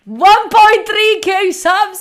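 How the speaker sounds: hyped-up call to chat about the sub goal; excited proclamation. Real, not acted. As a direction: announce the milestone like a host. Announcing a sub goal, louder.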